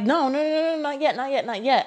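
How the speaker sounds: high, repeated pleading; retold panicked plea. Patient pleads as surgeon starts cutting, retold panic. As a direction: replay begging them to hold off.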